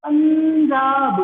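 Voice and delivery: पंजाब drawn out slowly, nasal after प; slow pronunciation demo. Shown as a demonstration. Teacher asks class to say पंजाब, slow demo. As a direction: stretch पंजाब so the nasal follows प. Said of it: "बोल के देखो".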